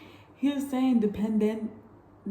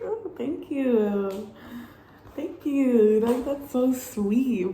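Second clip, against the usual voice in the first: high, drawn-out sweet thanks; gushing thanks; 4 semitones higher, 5 dB louder, much slower than usual. Retold or imitated. Replaying her reply to a guy's hair comment, gushing thanks. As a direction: gush slowly in a raised voice, hamming it up.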